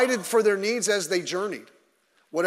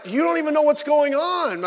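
exasperated retort; 10 semitones higher, 5 dB louder than usual. Retold or imitated. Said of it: "I'm like".

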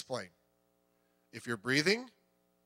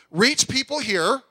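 loud, high, driving preacher; rally the congregation with fire; fiery pulpit exhortation; full-force preaching; 7 semitones higher, 7 dB louder than usual. Acted.

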